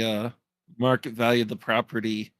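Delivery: ordinary talk, nothing marked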